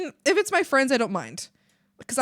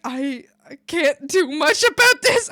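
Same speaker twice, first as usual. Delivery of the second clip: raised, hammed-up overwhelm breaking into laughter; mock helplessness; 4 semitones higher, 7 dB louder, slower than usual. Recalling being overwhelmed beside Mimi, mock helplessness.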